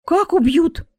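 high, loud shocked question; alarmed realisation. A narrator's reading. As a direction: ask in sudden alarm. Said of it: "спохватилась Марина".

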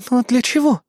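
high, bewildered question; puzzled. A narrator's reading. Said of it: "Кайли озадаченно посмотрела на меня".

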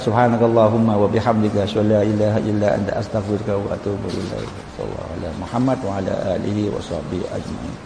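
slow, low, soft Arabic recitation; closing prayer. Real, not acted. Preacher ends the sermon with a quiet slow prayer. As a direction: close the session with a quiet murmured prayer.

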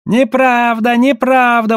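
high-pitched outraged denial; exclaiming. A narrator's reading. Trader denying he cheats, exclaiming. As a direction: cry out against the accusation. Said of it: "воскликнул Гай".

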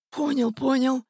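put-on high, placating old man's voice; servile old man. A narrator's reading. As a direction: agree meekly in a high voice. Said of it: "быстро закивал Графка". (заискивающе, высоким стариковским голосом)

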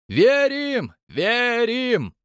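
very high, loud rapturous chant; crowd cheer. A narrator's reading. Nelyudov rows answering, rapturous cheer. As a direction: cry it out like an ecstatic crowd. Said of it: "понеслось по рядам Нелюдовых".

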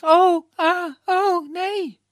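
high, loud frightened gasps; panicked cry. A narrator's reading. Elena flees from Iskander crying out in loud high panic. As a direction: cry out in fear while running.

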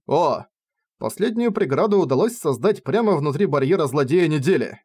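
ordinary delivery, nothing marked

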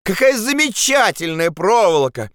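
high shout of exaggerated praise. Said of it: "крикнул Андрес". (громкая преувеличенная похвала)

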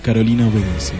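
ordinary talk, nothing marked

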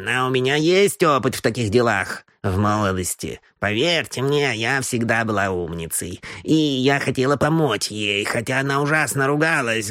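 put-on an old gossip sipping gin as she chatters; old housekeeper. Housekeeper rambles to the gentlemen over gin, gossiping old woman.

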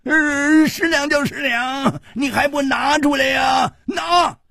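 put-on very high old man's shout; pestering old vendor yelling at top pitch; nagging old man. A narrator's reading. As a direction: demand it shrilly, over and over. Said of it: "那个老头不住地说".

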